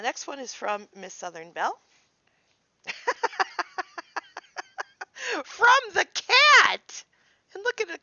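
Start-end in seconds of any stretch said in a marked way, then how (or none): 2.8-5.4 high squealing laugh; delighted laugh. Real, not acted. 5.4-8.0 very high, loud, slow squeal; overjoyed cry at the cat card; delighted shout. Real, not acted.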